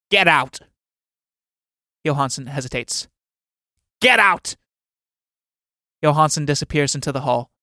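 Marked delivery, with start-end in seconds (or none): 0.1-0.6 stern order. Acted. Old man orders servant out. 4.0-4.6 louder, higher second shout; angry shout. Acted. Old man shouting at hesitating Johansson, furious. Servant hesitates, order repeated.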